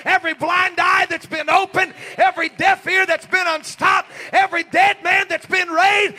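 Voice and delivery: high, loud preacher's shout building to a peak; full-force preaching. Acted. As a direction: cry it out to the congregation, high and very loud.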